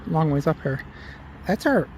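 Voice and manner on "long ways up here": breathless after a steep climb; out of breath from climbing. Real, not acted. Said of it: "if I sound like I'm out of breath, it's because I'm out of breath".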